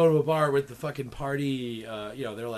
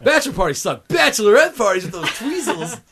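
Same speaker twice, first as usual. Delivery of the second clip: loud, high bachelorette-party holler; raised, hollering voice over the crowd; rowdy party shout; 6 semitones higher, 10 dB louder than usual. Acted.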